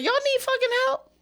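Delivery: angry yell. Retold or imitated. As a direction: bang on the door and yell at the neighbors. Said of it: "knock on that damn door and be like".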